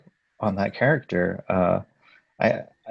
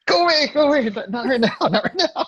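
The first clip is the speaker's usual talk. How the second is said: mock shooing; 3 dB louder than usual. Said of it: "I'm like".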